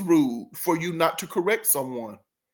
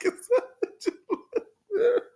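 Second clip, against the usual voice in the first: high, slow "It's Angela" through laughter; laughing mimicry; much slower than usual. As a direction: laugh while you mimic her. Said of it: "this is just like".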